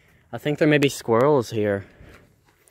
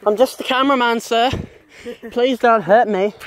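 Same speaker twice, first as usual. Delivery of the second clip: pleading; 11 semitones higher, 5 dB louder than usual. Real, not acted. Pleading with angry neighbour.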